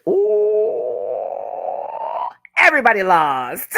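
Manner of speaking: high, drawn-out whoop into exclamation; hammed-up, high-pitched exclamation; whooping retold reaction. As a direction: hoot it high, then proclaim it. Acting out own reaction to reveal.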